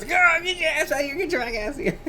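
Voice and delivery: high, rough tomboy bark; high gruff tomboy yell. Acted. Tomboy girl yelling at the boy, high and rough.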